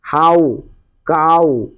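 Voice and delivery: "हाउ", "काऊ" each with a drawn ah-oo glide; ow sound. Shown as a demonstration. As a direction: model "हाउ", pause, then "काऊ", both ending in ow. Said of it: "यहाँ आऊ निकल रहा है". ("हाउ", "काऊ" में आऊ की आवाज़)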